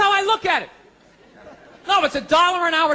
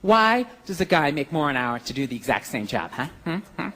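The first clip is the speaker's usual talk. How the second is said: put-on lower, softer demanding girlfriend; lightly voiced nagging girlfriend; mock girlfriend; 11 semitones lower, 4 dB quieter than usual. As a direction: challenge him like a lecturing girlfriend. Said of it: "she goes".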